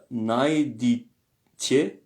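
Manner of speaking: najditje drawn out very slowly, split into syllables. Shown as a demonstration. Teacher introducing a word to students, syllable by syllable. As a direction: say najditje slowly with a gap before the last part.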